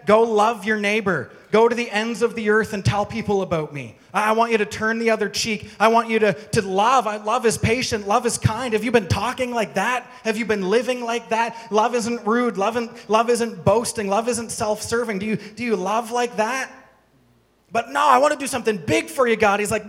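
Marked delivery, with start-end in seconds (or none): 0.1-8.7 raised, louder preaching of God's words. Acted. Preaching at full force. 8.7-16.7 higher, louder preaching challenge. Acted. Preaching at full force. 17.7-19.6 quick, higher mock pleading believer; eager believer.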